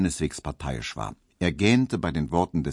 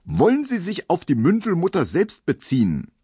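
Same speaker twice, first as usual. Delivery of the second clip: higher and louder, a stern judge; raised voice; 9 semitones higher, 4 dB louder than usual. A narrator's reading. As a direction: lift the voice so the hall looks up. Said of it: "Der Richter hob die Stimme".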